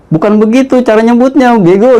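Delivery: high, laughing insult; mock him for botching it; scornful brother. Brother mocking his wrong mantra pronunciation, scornful.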